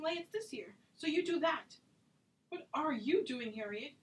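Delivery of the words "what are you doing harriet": loud, exasperated yelling at her friend; furious, demanding answers; angry shouting. Acted. Friends' heated quarrel over marriage.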